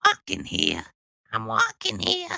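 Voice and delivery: lightly voiced New York cat; a hint of a New York street guy; New York accent. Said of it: "she has a New York cat accent".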